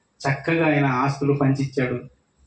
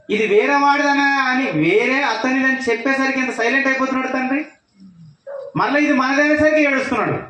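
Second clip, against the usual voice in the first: fervent preaching; 8 semitones higher than usual. Real, not acted. Preacher exclaiming to the children about the father, raised high. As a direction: drive the moral home with a raised voice. Preacher drives home the point.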